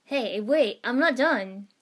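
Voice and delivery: loud, flustered protest. A narrator's reading. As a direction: call out to cut off the celebration. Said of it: "Iruka-sensei protested".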